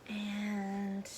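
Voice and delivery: usual speaking voice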